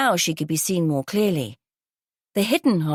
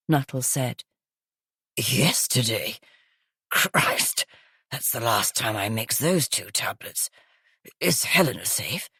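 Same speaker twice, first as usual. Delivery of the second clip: gravelly, smoky voice; 6 semitones lower than usual. Acted. Groggy guest exclaiming to police in a low smoky rasp. Croaky woman character voice.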